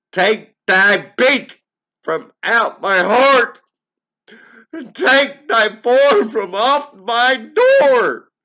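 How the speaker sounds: high and loud, slowing on the last commands; frantic shrieking. Acted. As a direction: drive the bird out with screams. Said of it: "I shrieked".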